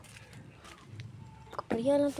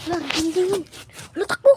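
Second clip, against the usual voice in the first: rising panicked yell, louder, higher; panicked shout; 5 semitones higher, 12 dB louder than usual. Real, not acted. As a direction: yell to flee in sudden panic. Panicked fleeing in game.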